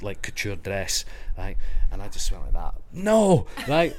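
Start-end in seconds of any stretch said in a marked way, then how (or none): 2.9-3.5 high, loud shouted "no"; retold shout. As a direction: re-enact a panicked shout of no. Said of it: "but I shouted".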